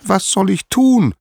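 very high, loud wail of a question; high-pitched, helpless appeal; desperate cry. Acted. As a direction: cry out as a trapped goddess. Trapped Hera cries out to Zeus.

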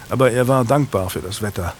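plain reading voice

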